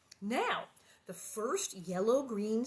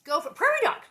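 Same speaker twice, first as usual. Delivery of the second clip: usual speaking voice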